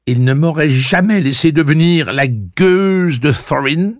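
heated, emphatic reproach; indignant protest. A narrator's reading. Heated quarrel, niece accuses aunt.

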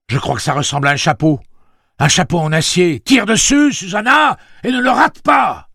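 loud and high, rising to a shout; ordre crié. A narrator's reading. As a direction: call out instructions, building to an urgent command. Urgent orders to shoot the bear. (consignes criées, de plus en plus fort)

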